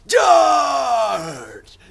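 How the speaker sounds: high, drawn-out hammed-up war shout; battle cry. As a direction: bellow a mock cavalry charge. Mock battle cry of hunters.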